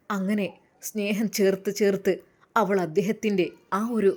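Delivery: ordinary delivery, nothing marked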